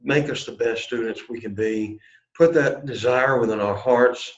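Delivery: earnest spoken prayer. Real, not acted. Teacher praying for the class, earnest prayer. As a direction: pray it humbly to the Lord.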